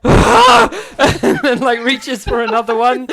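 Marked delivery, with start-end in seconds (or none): 0.0-0.7 pained yelp. Acted. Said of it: "looks up at you like".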